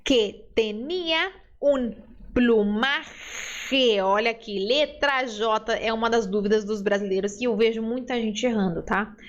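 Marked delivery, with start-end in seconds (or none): 0.0-4.0 very slow, careful Spanish read for repeating; unhurried Spanish teacher reading aloud; slow model reading. Shown as a demonstration. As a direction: read it out slowly for students to copy. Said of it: "Eu leio e você lê depois de mim".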